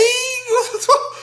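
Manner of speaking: high squealing laugh. Real, not acted. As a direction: burst out laughing. Burst of laughter.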